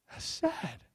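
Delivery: ordinary delivery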